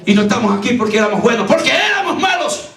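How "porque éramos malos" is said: high preacher's punchline cry; emphatic preaching. Acted. Preacher admitting "we were bad", emphatic preaching. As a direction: drive the confession home, pitched high.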